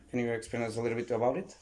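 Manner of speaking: ordinary talk, nothing marked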